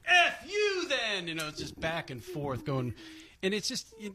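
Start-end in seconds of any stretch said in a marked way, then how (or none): 0.1-1.3 high, slow indignant snap; drawn-out sarcastic comeback; mock angry retort. Retold angry internet comment.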